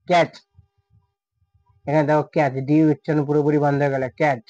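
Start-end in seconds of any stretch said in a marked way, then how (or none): none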